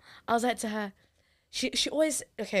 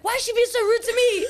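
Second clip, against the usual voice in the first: high, loud, whiny complaint; childish complaint; 13 semitones higher, 7 dB louder than usual. Retold or imitated. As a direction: sulk like a wronged kid. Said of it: "And I'm like".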